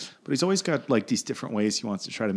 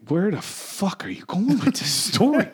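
high, drawn-out exasperation; baffled impatience; 9 semitones higher than usual. Retold or imitated. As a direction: drag it out in disbelief. Retold impatient reply to stepdad.

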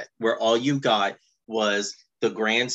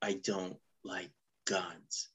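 deadpan mumble; 6 semitones lower, 12 dB quieter, slower than usual. Retold or imitated. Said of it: "Lifeline is like".

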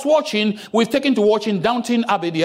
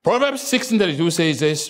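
ordinary talk, nothing marked

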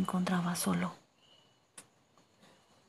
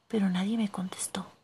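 ordinary delivery

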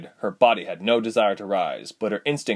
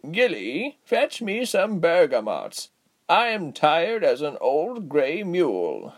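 slow, pitched-up, weary character voice; raspy tired Giselle; 8 semitones higher, slower than usual. A narrator's reading. Giselle sending Gilly for bergamots, slow and weary. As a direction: drag out the order as a tired old woman. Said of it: "Giselle said from outside".

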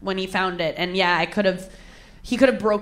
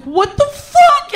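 retold panicked wail, loud and very high; freaked-out crying; 8 dB louder, much slower than usual. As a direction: drag it out in high, loud mock horror. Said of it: "And I started crying instantly".

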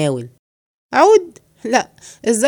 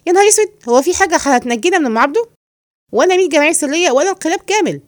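in fright; 7 semitones higher than usual. Acted. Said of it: "قول، قول، في فزع".

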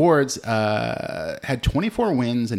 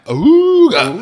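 cartoon awooga; 14 semitones higher, 17 dB louder than usual. Ogling sexy cartoon Jessica Rabbit.

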